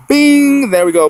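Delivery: a loud, high "Bing!" to snap back; bright exclamation. Real, not acted. A loud "Bing!" for the viewers as he returns to his story. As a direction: pop back out of the tangent.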